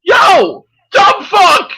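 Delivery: high, loud jeering yell; loud high taunting shout; mock shout at screen. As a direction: bellow an insult at the TV. Yelling at the stream, retold.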